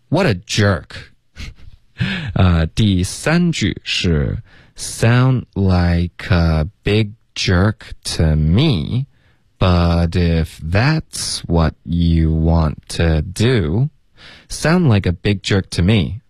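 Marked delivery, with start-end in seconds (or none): 4.8-13.9 very slow teacher model, sounds linked; slow linking demo. Shown as a demonstration. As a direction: draw each word out for learners. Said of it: "我们请老师来先示范一下". (放慢速度示范连读)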